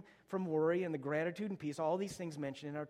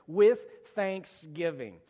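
with thanksgiving stretched slow and louder; with thanksgiving drawn out, each word weighted; slow emphasis; 7 dB louder, much slower than usual. Shown as a demonstration. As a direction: lean on every word of with thanksgiving. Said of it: "this little phrase".

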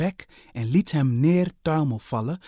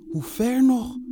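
whining; 12 semitones higher, 4 dB louder than usual. Acted. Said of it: "zeurde Onda".

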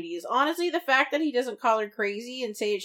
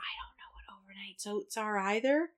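whisper; 6 dB quieter than usual. Real, not acted. Whispered reply to cohost.

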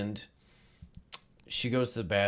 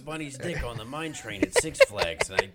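higher, put-on Jersey accent; old Jersey guy; 3 semitones higher than usual. Old man recounting head injury, hammed-up Jersey accent. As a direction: tell it as a mock Jersey old-timer. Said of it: "I talk like this now".

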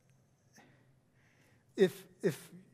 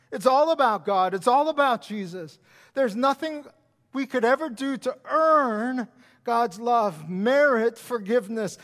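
loud fast preaching; preaching at full force; 2 semitones higher, 5 dB louder than usual. Real, not acted. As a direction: drive it home to the congregation.

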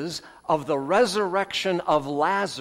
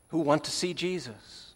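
ordinary talk

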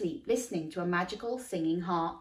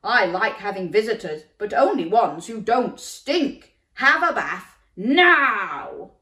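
giant; 2 semitones higher, 8 dB louder than usual. A narrator's reading. Giant orders Jack.

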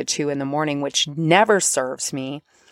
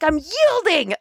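much higher, gritted "I'm yielding"; mock strained yielding; 11 semitones higher than usual. Mocking her own forced surrender, high strained. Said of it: "I'm like".

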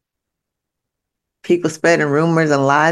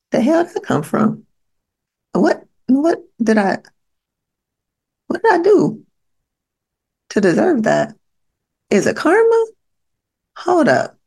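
much higher, baffled mock disbelief; bewildered; 10 semitones higher than usual. Imagining yourself hit by life out of nowhere, much higher baffled voice. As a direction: act stunned, like life just hit you. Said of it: "you're sitting here like".

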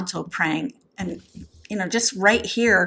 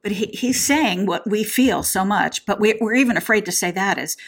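ordinary talk

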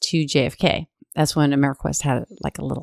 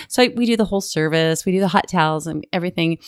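ordinary delivery

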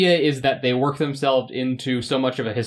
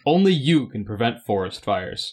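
ordinary talk, nothing marked

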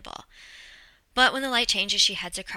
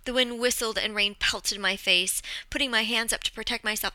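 usual speaking voice

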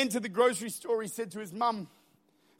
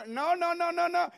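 lightly put-on Indian accent, higher pitch; a hint of an Indian accent, raised pitch; accidental Indian accent; 6 semitones higher than usual. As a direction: slip into a mock Indian accent. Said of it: "why I did an Indian accent".

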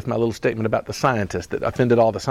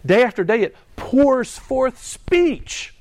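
slow, high, lifted scripture reading; reverent psalm reading; 10 semitones higher, much slower than usual. Acted. Preacher reads Psalm nineteen to the congregation, slow and lifted. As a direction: read the verse slowly, up high, with awe.

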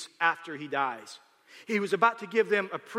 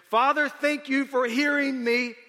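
loud prayer; 7 semitones higher, 7 dB louder than usual. A narrator's reading. Jesus thanking the Father before the tomb, loud voice. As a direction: pray aloud so the whole crowd hears. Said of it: "in a loud voice".

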